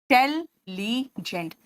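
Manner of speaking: "Intelligent" slowly as in-tel-li-gent; broken into syllables. Shown as a demonstration. Teacher counting syllables for students, slow and segmented. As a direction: separate all four syllables of "intelligent" with pauses. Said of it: "four शब्द खंड है".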